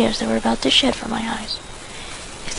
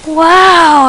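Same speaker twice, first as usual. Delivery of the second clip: loud, high wow of awe; gasp in awe; 6 semitones higher, 10 dB louder than usual. Acted. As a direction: let it burst out in amazement. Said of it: "everybody gasped in awe".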